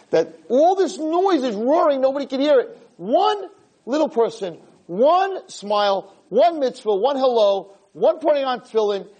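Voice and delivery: fervent build on every "one"; impassioned preaching. Real, not acted. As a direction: hammer each item home, high and stretched. Preacher drives home his moral.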